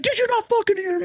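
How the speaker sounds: imitating the angry man spraying the hose; shrieking mock rage. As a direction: squeal it like a furious homeowner. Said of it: "He's like".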